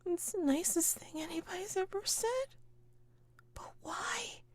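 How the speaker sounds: tiny, high, soft tearful voice; tearful grieving woman. Acted. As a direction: squeak it out softly through grief. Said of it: "And she says".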